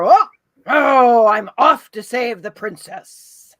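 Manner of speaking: loud, booming heroic prince. Shown as a demonstration. Guest demonstrating a prince off to a rescue, loud heroic voice. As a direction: proclaim it like a storybook hero. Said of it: "is the prince the same way?".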